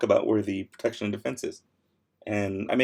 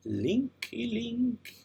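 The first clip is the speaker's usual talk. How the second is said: playful singsong; 9 semitones higher, 5 dB quieter, much slower than usual. Pretend.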